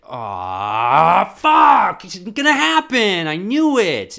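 loud, high angry outburst; cursing out loud at the letdown; frustrated yell. Retold or imitated. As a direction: shout it in frustrated dismay. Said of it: "And then I was just like".

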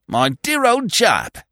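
high, drawn-out hearty greeting; delighted cry. A narrator's reading. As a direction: hail a long-lost friend slowly and high. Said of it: "he cried".

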